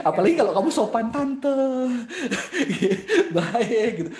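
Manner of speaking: loud, high-pitched, breaking into laughter; laughing while talking. Real, not acted. Speaker amused by a polite "Tante" greeting, laughing loudly. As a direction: talk through a burst of laughter.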